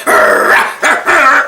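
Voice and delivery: imitated rough dog growl; mad dog barking. Roller answering being called mad dog, barking. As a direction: bark and snarl like a mad dog. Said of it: "I think Roller is a mad dog".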